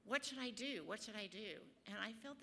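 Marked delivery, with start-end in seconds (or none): 0.0-0.8 louder, pleading cry to God. Retold or imitated. Runner crying out to God on the beach, pleading. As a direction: call out the question in desperation. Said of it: "I just cried out to God".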